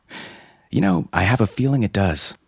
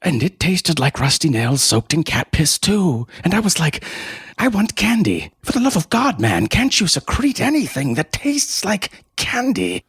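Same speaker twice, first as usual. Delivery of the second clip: getting more and more worked up; rising outraged rant; 10 semitones higher than usual. Acted. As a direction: pitch climbing higher and higher. Disgusted rant about candy.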